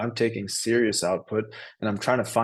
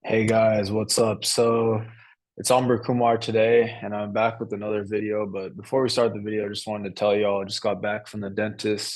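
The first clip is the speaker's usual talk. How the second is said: ordinary delivery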